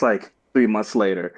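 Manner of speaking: ordinary talk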